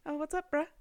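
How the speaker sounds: high-pitched, awkwardly fake-cool greeting; trying to be casual. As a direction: act casual and overshoot it. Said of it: "tried so hard to be, like, casual".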